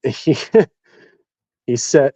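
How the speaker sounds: ordinary talk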